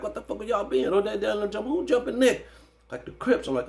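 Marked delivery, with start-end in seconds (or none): 0.0-2.5 frantic questioning. Retold or imitated. Frantic questions about jumped cousin.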